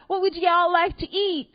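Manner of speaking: put-on Southern accent. Hooters waitress taking the order, light Southern accent. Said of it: "'Cause they all have Southern accents in my head".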